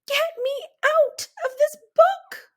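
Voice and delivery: high, loud, panicked scream; terrified high scream to get out. A narrator's reading. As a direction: shriek in the haunted dark. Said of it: "and I screamed".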